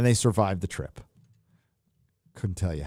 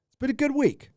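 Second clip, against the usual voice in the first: high squeaky repeat. Shown as a demonstration. Repeating his own line to chat, high and playful. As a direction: jump way higher on the repeat.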